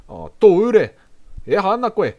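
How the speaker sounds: loud, high teasing jab; mock insult shouted. Shown as a demonstration. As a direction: fire it back at your mate, loud. Guy insults another guy.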